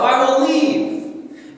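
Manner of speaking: slow, drawn-out, high and loud; God's resolve. Acted. God announcing he will leave, slow and loud. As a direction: stretch each word out as a painful farewell. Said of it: "He says".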